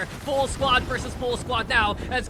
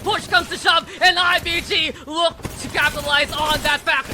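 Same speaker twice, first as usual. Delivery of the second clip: shouted, rising play-by-play; hype esports caster; 6 semitones higher, 8 dB louder than usual. Real, not acted. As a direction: build to a hype yell. Caster hype on final fight.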